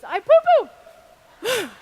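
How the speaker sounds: put-on very high, loud, drawn-out little-kid voice; imitating a toddler. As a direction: mimic a two-year-old proudly announcing it. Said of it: "taps her diaper and goes".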